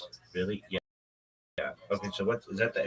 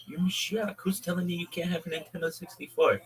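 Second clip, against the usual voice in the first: indignant surprise; 9 semitones higher than usual. Retold or imitated. Friend indignant for Mashiach.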